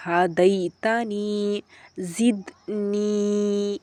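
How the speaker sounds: ہدیتنی and زدنی with the ee held very long; long ee drawn out. Shown as a demonstration. Teacher drilling long ee words. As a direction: stretch the ee slowly. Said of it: "یائے مدہ کی مشق".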